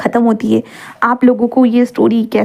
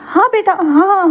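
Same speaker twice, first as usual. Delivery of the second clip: higher, slow, stammering assent; flustered yes; 7 semitones higher, much slower than usual. Acted. Flustered mother agreeing to her son, high and slow. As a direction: caught off guard, stretch out the yes.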